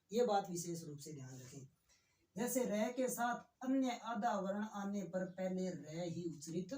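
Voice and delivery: normal talking voice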